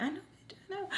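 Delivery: high, hushed knowing retort; inner voice. Retold or imitated. Narrator thinking back at friend, hushed and high. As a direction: say it under your breath, high and smug. Private thought in head.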